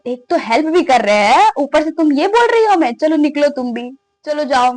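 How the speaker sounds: a hint of an offended friend; mock indignant. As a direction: tell them off in a mock huff. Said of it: "और वो कह रहा है".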